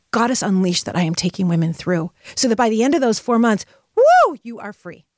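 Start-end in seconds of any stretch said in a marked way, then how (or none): 3.9-4.4 high, loud "woo"; short burst of triumph; celebratory whoop. Acted. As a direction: whoop with release. Celebrating being set free.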